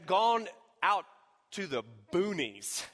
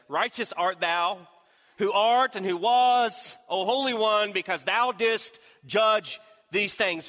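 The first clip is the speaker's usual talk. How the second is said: slow, loud, high proclaiming; preaching scripture; 6 semitones higher, 7 dB louder, slower than usual. Acted. Pastor reading the angel's praise to the church in full preaching force.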